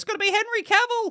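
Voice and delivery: hammed-up giddy fan shriek; overexcited fan. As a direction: blurt it like a fan who can't contain the hype. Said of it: "I've already seen people say".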